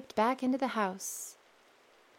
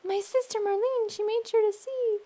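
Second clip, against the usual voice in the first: bird's song; 12 semitones higher than usual. Acted. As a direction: pipe it out high and bright. Bird sings.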